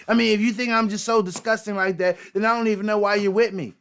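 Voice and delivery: high, loud mock of a defensive cheater; mocking cheating girlfriend. Mocking a cheater who blames her partner instead. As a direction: play the caught cheater turning it around. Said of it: "that's what Mister Boykin sound like".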